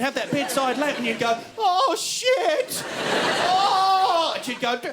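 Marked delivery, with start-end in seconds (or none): 1.6-4.3 high startled cry of oh shit; startled yelp. Retold or imitated. Kid grabbing for the lamp in the dark, startled yelp. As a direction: yelp like you just got spooked in the dark.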